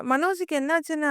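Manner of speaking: usual speaking voice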